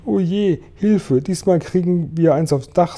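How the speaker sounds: high, worried wail for help; high-pitched story-reader's alarm; alarmed cry. A narrator's reading. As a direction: cry out in mild panic.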